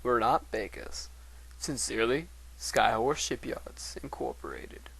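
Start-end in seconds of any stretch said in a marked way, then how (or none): 4.0-4.9 whispered afterthought. Acted. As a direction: breathe the word out in a whisper. Whispered company sign-off.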